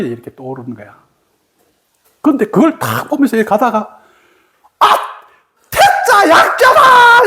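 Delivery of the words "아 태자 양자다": high, loud, drawn-out yell; hammed-up awakening shout, last syllable held; acted shout of revelation. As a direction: cry it out as a sudden discovery. Retells friend's shouted revelation.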